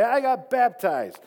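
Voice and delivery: high, loud, slow proclamation; voicing a neighbor's hammed-up proud boast; mock proud announcement.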